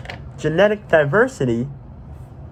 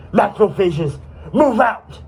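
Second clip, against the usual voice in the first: shouted military order; 6 semitones higher, slower than usual. Acted. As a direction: order the troops out. Commander orders troops out.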